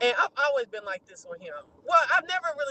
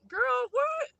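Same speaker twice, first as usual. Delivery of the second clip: incredulous; 13 semitones higher than usual. Real, not acted. Scoffing at her homegirl's suggestion, high and incredulous. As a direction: sound shocked at the idea.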